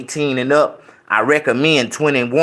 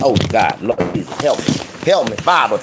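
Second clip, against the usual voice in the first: mock grace prayer; 5 semitones higher than usual. Prays before eating.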